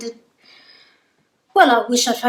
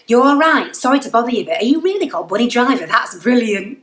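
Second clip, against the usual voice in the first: bright, louder northern English voice; northern accent; 4 semitones higher, 5 dB louder than usual. Retold or imitated. Imitating the Doctor's greeting, in a northern accent. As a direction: put on a chirpy Northern accent. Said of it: "I'll try and do the accent".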